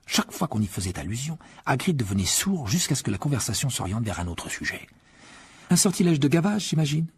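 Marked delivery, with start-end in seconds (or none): 5.7-7.1 higher, half amused, half scolding; amused disapproval. A narrator's reading. Hermione guessing Hagrid's spell, amused but disapproving. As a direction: tease him while disapproving. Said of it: "entre l'amusement et la réprobation".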